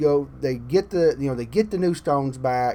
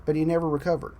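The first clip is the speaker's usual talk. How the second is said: ordinary delivery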